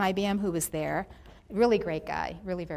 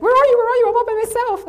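loud, high-pitched mock panic; imitated panicked shouting; 15 dB louder, faster than usual. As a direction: act out someone lost, yelling for help. Lost man's panicked call, acted out.

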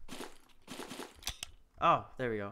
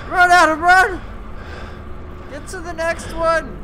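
panicked yelling; 9 semitones higher, 13 dB louder than usual. Real, not acted. Fleeing monster, panicked yelling.

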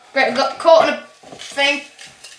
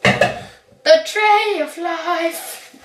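very slow, drawn-out proclamation; stretched-out, portentous delivery; mock-grand; much slower than usual. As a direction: announce it like a sacred object. Mock-grand naming of a baking tray.

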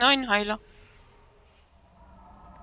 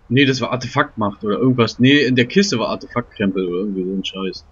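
loud low mock voice; 9 semitones lower, 10 dB louder than usual.

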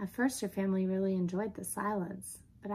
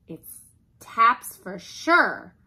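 loud, slow, raised doom-saying; dramatic dread; 4 semitones higher, 11 dB louder, much slower than usual. Acted. Dog facing burglar with a pot, dreading. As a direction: draw it out as if doomed.

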